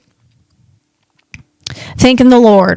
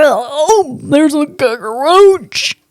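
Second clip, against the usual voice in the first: startled; 3 semitones higher, much slower than usual. Retold or imitated. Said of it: "I was so startled".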